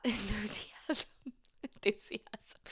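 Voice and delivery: "Enthusiasm" whispered slow and soft with a laugh; a giggly, suggestive whisper of "Enthusiasm"; dirty-sounding whisper. Shown as a demonstration. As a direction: breathe "Enthusiasm" out like it's naughty. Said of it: "That word sounded dirty".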